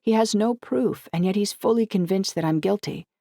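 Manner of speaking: acted indignation, voice lifted; indignant. A narrator's reading. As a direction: protest your innocence indignantly. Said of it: "My face heated with indignation".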